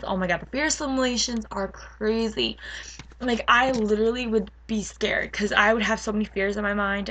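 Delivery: ordinary talk, nothing marked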